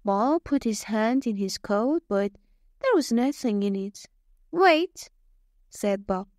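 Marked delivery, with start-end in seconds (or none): 4.5-5.1 quick call. Acted. Bob stops the old man.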